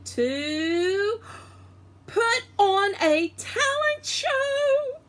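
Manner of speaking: slowing, rising high on "show"; drawn-out announcer swoop; dramatic announcement. A narrator's reading. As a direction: build it up like big news. Teacher announces talent show dramatically.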